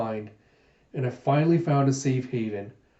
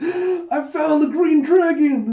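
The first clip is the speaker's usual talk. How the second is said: high, loud, slow shout of delight; overjoyed cry; 7 dB louder, slower than usual. Acted. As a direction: crow it out like a thrilled kid. Gita getting all excited.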